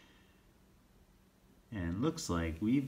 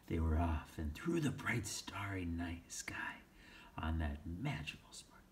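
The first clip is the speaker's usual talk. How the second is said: whispered; 3 semitones lower, 7 dB quieter than usual. Shown as a demonstration. Re-read, first take whispered.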